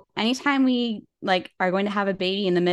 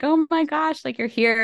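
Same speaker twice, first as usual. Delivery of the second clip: high-pitched retold wonder at newborn; retold tearful awe; 8 semitones higher than usual. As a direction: re-enact overwhelmed joy, pitched up, no real crying. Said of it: "crying and being like".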